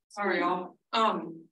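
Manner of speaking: chewing while talking; mouth full. Real, not acted. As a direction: speak round a mouthful of pizza. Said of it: "now that you've got a mouthful of pizza".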